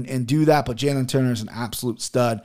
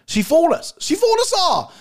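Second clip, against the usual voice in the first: mock outcry. Commentator on a fighter who fooled everyone, mock high outcry. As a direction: cry it out high, as if duped.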